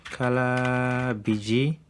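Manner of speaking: slow, held first word; drawn out while typing. Real, not acted. Coder typing the background color variable aloud, drawn out. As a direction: stretch it out as you type.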